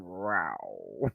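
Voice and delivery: low, soft, stretched "rraow"; a playful low feline growl; imitated cat yowl. Vlogger showing their reaction to the costume, mock cat yowl. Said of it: "and just going".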